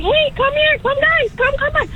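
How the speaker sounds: high-pitched imitation of kids waving pals forward; kids calling friends over. Chaperone mimics line-cutting kids beckoning friends, high mock call. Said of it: "and they're like".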